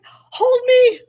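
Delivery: very high, loud squeaky plea through laughter; pleading guinea pig, laughing. Retold or imitated. Voicing Flower leaping at Sarah, high and laughing. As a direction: giggle out a tiny creature begging. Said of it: "She was like".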